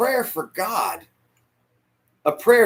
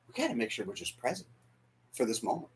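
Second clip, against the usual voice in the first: calm friend; 7 semitones lower, 13 dB quieter, faster than usual. Retold or imitated. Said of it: "He's like".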